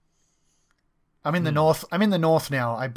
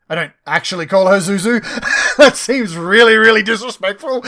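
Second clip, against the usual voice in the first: laughing, loud and high; real giggle through words; 9 semitones higher, 13 dB louder than usual. Real, not acted. Laughing aside after in-character line.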